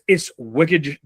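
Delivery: ordinary delivery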